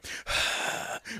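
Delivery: a long, put-upon sigh about shopping; dramatic exasperated sigh. Retold or imitated. Husband mocking a bored shopper: dramatic sigh. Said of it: "you hear me going".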